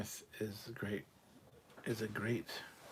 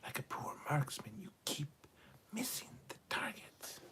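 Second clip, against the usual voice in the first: whisper; 5 semitones higher, 7 dB quieter than usual. Retold or imitated. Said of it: "Kirk says".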